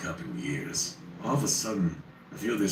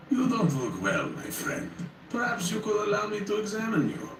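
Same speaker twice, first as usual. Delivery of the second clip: higher, silky-courteous plague doctor; polished, polite doctor; 14 semitones higher than usual. A narrator's reading. Plague doctor greeting the frightened narrator, polished and polite. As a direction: greet him like a too-courteous physician. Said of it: "his voice polished and polite".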